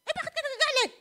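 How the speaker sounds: high, loud retort. Retold or imitated. Husband answering his scolding wife, high and loud. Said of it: "Mataas siya, tataas ako".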